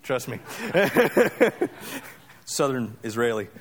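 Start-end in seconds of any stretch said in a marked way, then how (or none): 0.4-2.0 amused chuckling at his own slip; tickled, helpless chuckle; real laugh. Real, not acted. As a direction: laugh at yourself in a quick run.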